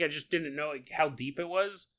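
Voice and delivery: ordinary talk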